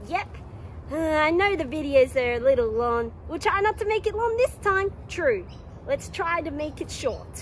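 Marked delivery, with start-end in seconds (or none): none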